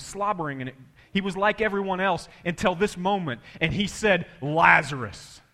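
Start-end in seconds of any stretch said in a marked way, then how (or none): 4.4-5.4 loud, slow, drawn-out summons; solemn, forceful summons; louder commanding call. Acted. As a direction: call the name out with weight. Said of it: "his voice got louder".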